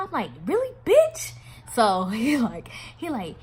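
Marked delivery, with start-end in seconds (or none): 0.4-1.3 very high, loud incredulous question; outraged disbelief. Retold or imitated. Woman confronts lying lover, loud high-pitched disbelief. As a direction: squawk it as an indignant challenge.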